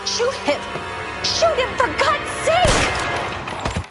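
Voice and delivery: high, desperate shriek, last words dragged slowly; terrified pleading. Acted. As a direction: beg in rising terror. Woman begging cop to fire.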